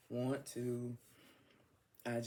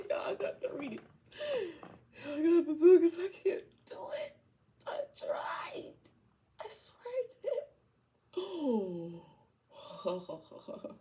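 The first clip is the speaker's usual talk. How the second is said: high, squeaky, voice giving out; helpless laughter; 4 dB louder than usual. Real, not acted. Reader gives up reading the card, helpless laughter. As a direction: gasp it out fast through tears of laughter. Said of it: "let me try to read this without laughing".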